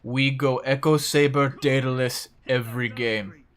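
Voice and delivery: put-on heavy Russian accent, broken English; [name]'s Russian accent. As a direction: deliver it like a Russian pro gamer. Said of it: "this is my Russian accent impression".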